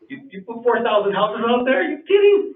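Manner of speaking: incredulous outrage. Real, not acted. Resident to the council on thousands of new houses, rising incredulous outrage. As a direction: pitch climbing high in disbelief. Angry resident objecting at hearing.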